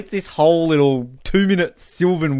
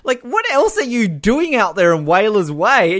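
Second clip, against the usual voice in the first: ordinary delivery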